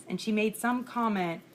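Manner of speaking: ordinary talk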